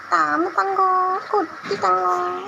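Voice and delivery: high, long-drawn sing-song endearments; affectionate cooing. Real, not acted. Cooing "little treasure" to pet Tom, high sing-song. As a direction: coo the pet names slowly, holding each vowel high.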